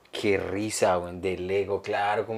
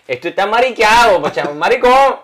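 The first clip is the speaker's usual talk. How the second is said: loud, higher mock Venezuelan outburst; Venezuelan accent rant; 10 semitones higher, 11 dB louder than usual. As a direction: shout the complaint back in his Venezuelan accent. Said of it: "para decirle".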